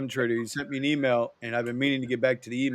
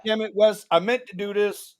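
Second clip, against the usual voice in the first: sudden realization; 6 semitones higher, 10 dB louder than usual. Real, not acted. Suddenly remembering.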